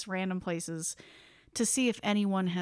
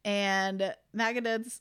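ordinary delivery

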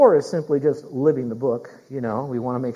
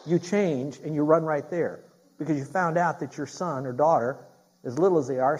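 usual speaking voice

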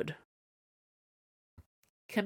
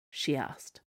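ordinary delivery

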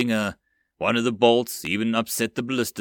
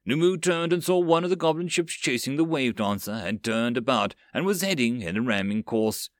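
ordinary delivery, nothing marked

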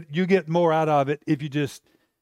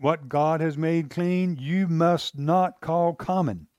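each word slow and weighty; slow solemn voice; slower than usual. Acted. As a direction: lay it down slowly like a verdict. God's voice answering Peter.

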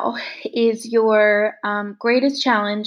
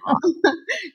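short high warm laugh; light laugh; 8 semitones higher than usual. Real, not acted. Host laughing along with the teary guest, light laugh.

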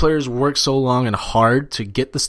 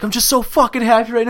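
high-pitched, overjoyed imitation; O'Reilly; 9 semitones higher than usual. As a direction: imitate a winner overwhelmed with happiness. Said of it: "O'Reilly's like".

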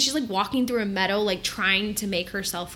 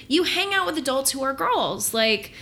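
normal talking voice

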